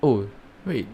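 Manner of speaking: ordinary talk, nothing marked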